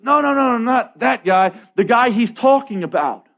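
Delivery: high, emphatic retold protest; insistent correction. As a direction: imitate a caller urgently setting someone straight. Girl insistently corrects the interviewer.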